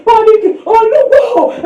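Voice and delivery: high, loud mock panic prayer; hammed-up panicking prayer, slowly wailed; mock panicked shout. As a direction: cry out like a frightened pray-er. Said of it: "you are shouting".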